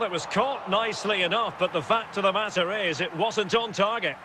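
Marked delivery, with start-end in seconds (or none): none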